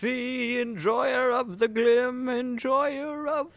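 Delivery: high, slow grand proclamation; mock biblical prophet. Mock archaic smiting proclamation.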